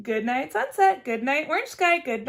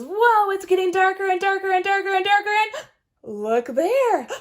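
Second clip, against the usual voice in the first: high, loud, excited chatter; Wordy Birdie amazed; 6 semitones higher, 8 dB louder than usual. Acted. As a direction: gush on and on in wonder. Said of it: "She says".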